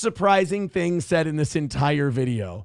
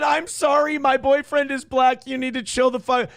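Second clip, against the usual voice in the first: raised, a touch singsong quote; lightly mimicking her; 8 semitones higher than usual. Vlogger mimicking the passenger's apology, raised and lightly mocking. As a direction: parrot her apology a little higher. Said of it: "She just said".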